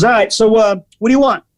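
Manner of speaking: mock brusque waiter asking for the order; pushy waiter. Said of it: "Really super aggressive".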